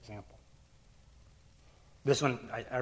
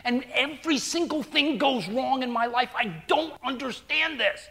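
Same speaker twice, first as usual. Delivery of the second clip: upset daughter; 8 semitones higher than usual. Retold or imitated. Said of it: "she's mad".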